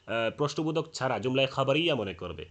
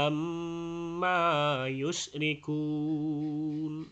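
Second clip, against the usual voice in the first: slow, drawn-out melodic tilawat; Quran recitation; much slower than usual. Shown as a demonstration. Teacher reciting the verses with long madd.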